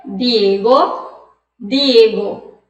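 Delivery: "Diego" with a hard dental D, no "dj"; Spanish D. Shown as a demonstration. Teacher models the name Diego in Spanish. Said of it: "Outra palavrinha".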